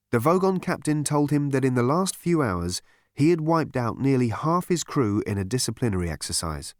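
ordinary delivery

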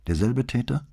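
clipped question with a high rising end; telegram style. A narrator's reading. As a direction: ask it in shorthand, straight to the point. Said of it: "fragte sie in dem Telegrammstil".

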